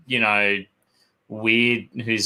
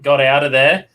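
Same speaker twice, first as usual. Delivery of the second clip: normal talking voice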